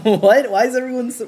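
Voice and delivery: loud, high outburst of disbelief; indignant surprise. Real, not acted. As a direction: burst out in mock outrage. Chat horrified by bagel habits.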